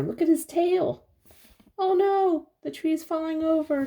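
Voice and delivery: high, animated, building alarm; excited. Acted. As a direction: gasp it out for a child. Excited reading to child.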